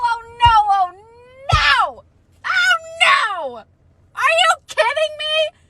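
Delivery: high loud anguished wail. Real, not acted. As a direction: wail in disbelief. Reader wails at plot.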